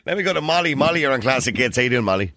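normal talking voice

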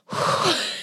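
a long breathy sigh of relief; big sigh of relief. Retold or imitated. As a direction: let all the tension out in one sigh. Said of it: "I do this big sigh of relief".

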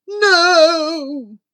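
loud, high, drawn-out cry; horrified scream. Acted. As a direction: cry out in refusal. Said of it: "The prince screamed".

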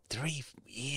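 very soft, under the breath; barely voiced murmur of disbelief; hushed echo. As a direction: repeat it almost silently to yourself. Same words echoed under the breath.

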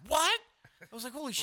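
high shocked yelp. Retold or imitated. Hearing the girl say his own name, a shocked high yelp. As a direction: jump up in shock.

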